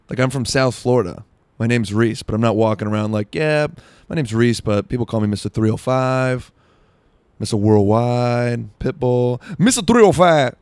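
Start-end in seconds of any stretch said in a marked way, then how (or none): 3.3-10.6 high, loud hype-man rapper voice; brash Miami rap-star swagger, pumped up. Pretend. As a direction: boast like a party rapper on the mic. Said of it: "Just me doing [name]".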